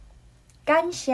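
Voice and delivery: "感谢" said slowly in Taiwanese; slow demo. Shown as a demonstration. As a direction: model "感谢" slowly for learners. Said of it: "So now let's try".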